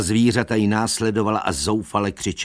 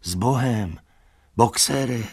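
lightly raised farewell call; calling goodbye; 5 semitones higher than usual. A narrator's reading. Animals bid Boxer farewell, calling out. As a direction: call out a farewell, a little higher. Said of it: "volali všichni".

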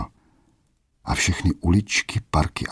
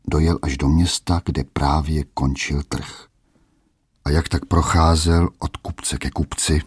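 plain reading voice

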